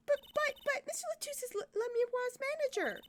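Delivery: put-on squeaky girl protest. Trucy protesting in a high voice.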